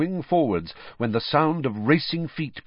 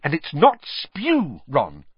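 hasty correction; 4 semitones higher, slower than usual. Acted. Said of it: "she said hastily".

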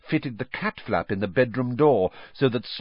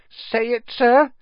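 high, pressing little elf voice; squeaky elf demand, rising in force; Dobby; 14 semitones higher, 6 dB louder, slower than usual. A narrator's reading. House-elf pressing Harry to promise, insistent.

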